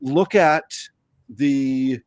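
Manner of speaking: ordinary talk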